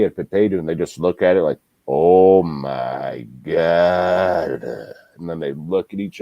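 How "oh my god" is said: slow, stunned "Oh my God"; drawn out in disbelief; awed gasp. Retold or imitated. Couple staring at their newborn lamb, awestruck.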